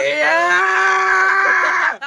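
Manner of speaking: drawn-out whooping yell; excited yell. Real, not acted. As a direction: bellow it out.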